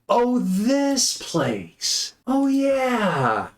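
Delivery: high, drawn-out cry sliding down; Saturni. Acted. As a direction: stretch each word long and high in character. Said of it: "you hear Saturni going".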